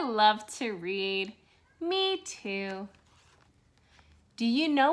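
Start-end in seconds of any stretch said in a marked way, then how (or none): none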